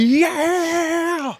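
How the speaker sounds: high, loud screaming yell; theme scream. Retold or imitated. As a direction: scream like the show intro.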